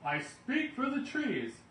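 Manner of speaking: high, loud, slow plea; high shouting Lorax; Lorax crying out. A narrator's reading. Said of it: "cried the Lorax".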